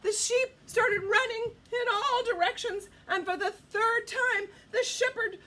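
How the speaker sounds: high, loud, urgent narration; excited storytelling. A narrator's reading. Storyteller telling children the wolves attack, excited and urgent. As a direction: raise pitch and volume as danger breaks out.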